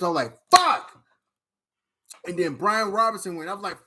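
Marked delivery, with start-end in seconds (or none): 0.5-0.9 high mock yell of frustration; sharp, high retold curse; frustrated curse. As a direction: blurt it out like the pick just vanished. Retelling frustration as picks vanish.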